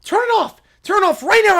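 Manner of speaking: put-on high, loud childish order; tantrum demand. Child wants the video stopped, tantrum demand.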